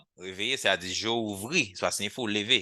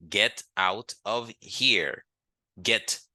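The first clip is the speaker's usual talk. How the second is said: slow model phrase; much slower than usual. Shown as a demonstration. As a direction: Get out of here stretched out, every word apart. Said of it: "slow model phrase for learners".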